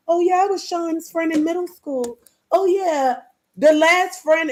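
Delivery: mocking fake friends. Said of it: "All of these friends coming back now".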